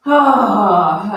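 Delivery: loud open-mouthed breath out on ah; audible exhale sigh. Shown as a demonstration. Yoga teacher showing the class a releasing exhale, loud sigh. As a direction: let the breath go with a big audible ah. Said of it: "and release through".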